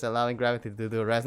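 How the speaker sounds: usual speaking voice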